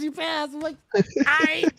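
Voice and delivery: high-pitched happy announcement; elated retelling. Retold or imitated. Driver retelling the examiner's verdict, elated. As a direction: lift it high with delight.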